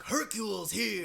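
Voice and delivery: a hint of a hammy announcer bellowing his own arrival; Hercule impression. Fan in the theater doing a loud, slow mock Hercule. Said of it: "I think he did one as, like, Hercule".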